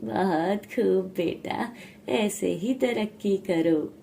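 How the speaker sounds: slow, stretched-out fatherly praise; approving father. Acted. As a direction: bless the son slowly and proudly. Father praises his son.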